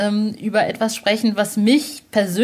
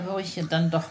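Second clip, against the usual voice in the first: ordinary delivery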